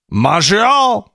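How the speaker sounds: Montreal said the French way, nasal-free, ending like "Mon-ré-al". Shown as a demonstration. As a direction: give Montreal a French twist. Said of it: "It's French".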